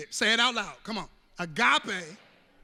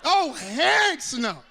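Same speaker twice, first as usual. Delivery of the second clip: loud, high comic protest; hammed-up loud refusal; mock refusal shout; 7 semitones higher, 7 dB louder, slower than usual. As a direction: shout it like you want no part. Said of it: "you go".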